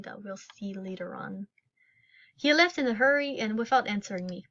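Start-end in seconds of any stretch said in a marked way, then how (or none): 0.0-1.5 whispering. Real, not acted. Whispered aside about the game.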